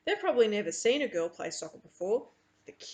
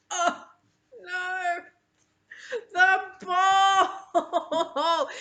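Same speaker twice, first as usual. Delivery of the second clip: dismayed cry; 9 semitones higher, 7 dB louder, much slower than usual. A narrator's reading. Ball lost, narrator dismay.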